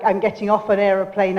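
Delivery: normal talking voice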